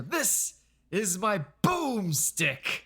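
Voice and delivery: slow, high, drawn-out proclamation; imitated hero's big catchphrase, stretched out; movie hero showing off. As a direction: present it grandly to a crowd. Quotes hero showing off his gun.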